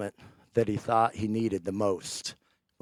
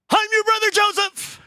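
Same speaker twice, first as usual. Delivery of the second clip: high, loud proclamation; dramatic reveal; 9 dB louder than usual. Retold or imitated. Joseph reveals himself to his brothers, loud and high. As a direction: announce it like a grand reveal.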